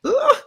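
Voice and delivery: high-pitched grossed-out groan; disgusted ugh. Real, not acted. Recapper grossed out by couple's moaning, disgusted groan.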